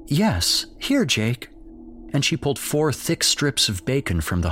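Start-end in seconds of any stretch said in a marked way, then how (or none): 0.1-1.5 more conviction. Acted. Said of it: "again with more conviction".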